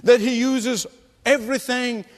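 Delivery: rising, impassioned sermon climax; fervent preaching. Acted. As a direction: preach it with mounting urgency. Builds to a passionate exhortation.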